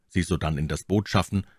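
ordinary delivery

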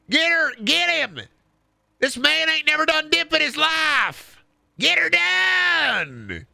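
high Southern shriek, last words stretched slow; hammed-up Southern holler. Riled accuser sets crowd on Larry, hammed-up Southern yell.

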